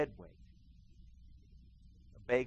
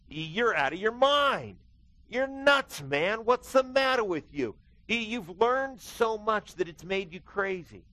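retold yell; 8 semitones higher, 5 dB louder than usual. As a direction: shout it at him like he's lost his mind. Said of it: "he yells out".

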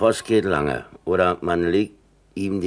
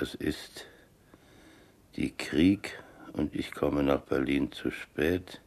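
whispered; 2 semitones lower, 6 dB quieter, slower than usual. Acted. Reading the letter aloud, suddenly whispering the line. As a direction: drop to a whisper mid-letter.

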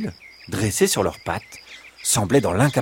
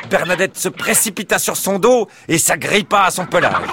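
urgent, raised-pitch action storytelling; breaking-action narration, keyed up and high; dramatic story narration; 6 semitones higher, 3 dB louder than usual. A narrator's reading. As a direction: drive the chase along with excitement. Narrates the fox's attack.

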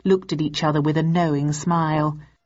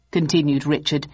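plain reading voice

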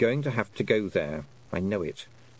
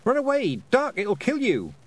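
high, loud urgent cry; urgent warning; 14 semitones higher, 4 dB louder than usual. A narrator's reading. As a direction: shout a warning as danger dives in. Leaps up warning of a diving buzzard.

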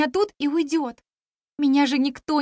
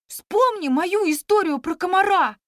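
eager; 6 semitones higher, 3 dB louder than usual. A narrator's reading. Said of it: "подхватил непоседа".

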